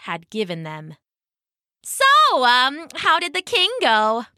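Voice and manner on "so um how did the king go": high, knowing tease; teasing frog voice. Acted. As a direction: needle him playfully.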